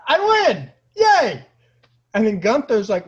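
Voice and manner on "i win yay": high, loud triumphant squeal; gleeful win. Retold or imitated. After being knocked out, gloating to his friends in a high loud squeal. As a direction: crow it like a kid who just won. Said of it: "And I'm like".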